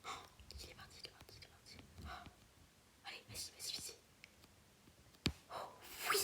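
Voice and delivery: whisper. Real, not acted. Tense sneaking, urging herself on.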